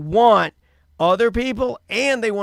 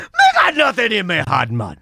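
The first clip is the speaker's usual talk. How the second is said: Jamaican patois, a bit louder; Jamaican accent; 3 dB louder than usual. Shown as a demonstration. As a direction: give the Jamaican line once more.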